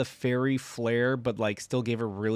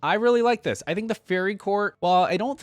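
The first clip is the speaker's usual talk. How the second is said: excited; 10 semitones higher, 7 dB louder than usual. Real, not acted. Excited reaction to favorite skin.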